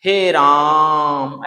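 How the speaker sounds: very slow, stretched wailing call of Ram; long drawn-out cry. Shown as a demonstration. Teacher demos Dasharatha's dying cry, stretched long. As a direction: drag the name out like a dying king. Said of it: "ऐसे करके लंबा बोलते हैं ना".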